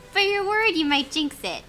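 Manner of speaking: ordinary talk, nothing marked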